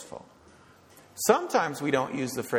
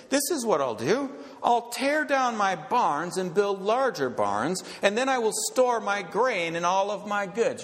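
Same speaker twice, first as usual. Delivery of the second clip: rich fool; 7 semitones higher than usual. Acted. Voicing the rich fool's plan.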